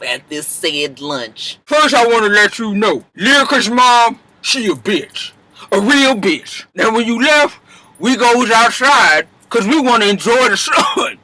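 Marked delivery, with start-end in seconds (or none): 1.6-11.2 a mother dishing gossip with relish; gossiping Mama Dap. Acted. Mama Dap telling her gossip.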